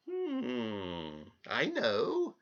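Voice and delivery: slow, odd little man voice; strange little man. Acted. Little man greets the crying girl, slow and odd. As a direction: greet her slyly and slowly. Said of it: "he said suddenly".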